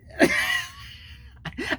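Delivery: high, squealing burst of laughter; amused laugh. Real, not acted. Laughing at own prank on Stevie, high laughter.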